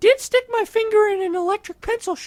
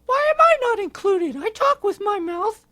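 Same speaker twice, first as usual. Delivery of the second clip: whiny monkey voice; 4 semitones higher, 5 dB louder than usual. Acted. Fred protests again.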